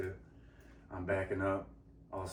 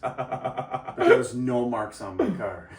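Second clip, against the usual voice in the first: laughing; 6 dB louder, slower than usual. Real, not acted. Laughing at own story.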